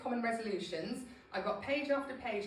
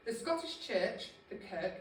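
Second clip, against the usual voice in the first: ordinary delivery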